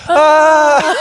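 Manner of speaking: high imitated scream. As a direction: mimic the friend's loud yell. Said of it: "scream so loud".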